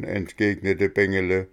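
ordinary delivery, nothing marked

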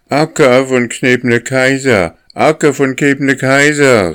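put-on high, loud whining cry of tired young geese; pitiful goslings. A narrator's reading. Tired goslings wailing up to their leader Akka, high and loud. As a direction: wail it up high like worn-out goslings. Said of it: "riefen sie in jämmerlichem Ton".

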